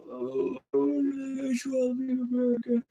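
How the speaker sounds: high, loud, breaking cry; anguished wail. Real, not acted. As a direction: wail it out in despair. Anguished cry about leaving.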